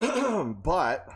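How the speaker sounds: normal talking voice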